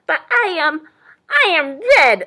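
high, loud, faltering boast; announcing cardinal. A narrator's reading. Cardinal grasps for a boast, high announcement. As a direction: announce it proudly as a bird. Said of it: "announced the cardinal".

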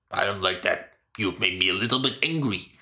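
low-pitched shopkeeper, a touch annoyed; lightly voiced low, grudging storekeeper; mildly angry shopkeeper. Shopkeeper turning down a haggler's offer, mildly angry. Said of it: "the guy's like".

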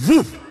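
a loud, high burst like a crowd rushing forward; sudden loud woof; whoosh sound. Retold or imitated. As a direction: blurt it out like a sudden rush of people. People rushing to the altar.

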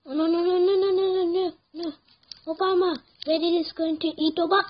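loud, high string of frantic cries; panicked shouting. Real, not acted. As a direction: shout in alarm as disaster closes in. About to be eaten in game.

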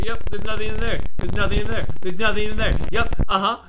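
yelling mockery at the screen; loud taunting. Real, not acted. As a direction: shout insults, gloating. Taunting the game AI loudly.

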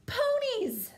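very high, loud princess bellow; bellowed demand. A narrator's reading. Reader voices princess demanding ponies, bellowing. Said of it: "bellowed the little princess".

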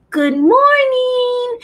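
very high, slow sing-song good morning; mother's lilting high hello to her child; cheery mom greeting. Shown as a demonstration. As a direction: show parents how to greet their little one. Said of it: "So moms always say".

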